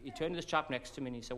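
normal talking voice

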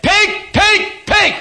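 high, sharp shouted warning; urgent yell. Acted. Lady driver yelling from her car window, high urgent shout. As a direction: yell it out in short, high bursts.

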